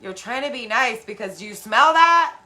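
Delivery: high, loud demanding cry; accusing yell. Retold or imitated. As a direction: call them out loudly. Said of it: "I'm like".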